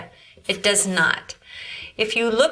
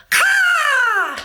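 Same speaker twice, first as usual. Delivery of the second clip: very high, loud crow caw; shrieked bird call; 4 dB louder than usual. Shown as a demonstration. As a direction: screech it like a crow. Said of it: "Or birds, they go".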